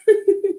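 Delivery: short high-pitched laugh. Real, not acted. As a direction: burst into a quick giggle. Laughing at own story.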